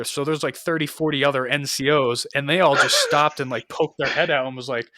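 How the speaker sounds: normal talking voice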